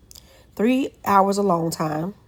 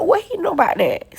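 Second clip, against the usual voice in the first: amused disbelief; 10 semitones higher than usual. Real, not acted.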